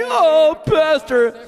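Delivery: high, loud mock wail; theatrical high-pitched lament; imitating dismayed women. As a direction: cry out to the pastor in hammed-up distress. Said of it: "the women would come along and say".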